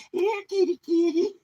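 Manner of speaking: very high sing-song calling "kitty"; squeaky teasing imitation calling for a cat; mock cat call. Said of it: "I bet she was out there in the trees like".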